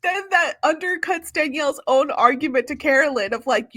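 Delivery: normal talking voice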